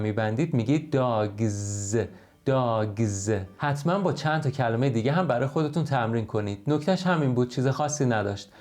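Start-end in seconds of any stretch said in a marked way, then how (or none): 0.9-3.3 "داگز" ending in a buzzed z; plural with z. Shown as a demonstration. Teacher demonstrating the plural, "داگز" with a drawn-out z. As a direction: say "داگز" as "dogzzz", held voiced ending.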